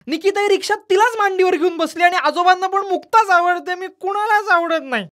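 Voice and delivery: put-on high, loud jealous boy pouring it out; angry hurt child. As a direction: accuse mother, near tears by the end. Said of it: "यश रागारागानं म्हणाला". (आईवर रागावलेला, दुखावलेला मुलगा, उंच आवाजात)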